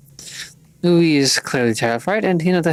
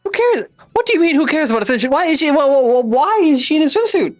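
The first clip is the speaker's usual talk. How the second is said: much higher and louder, fast and spluttering; outraged shout; 12 semitones higher, 4 dB louder than usual. Acted. Flustered girl shouts back at the swimsuit stranger, outraged and spluttering. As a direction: yell it in stuttering disbelief.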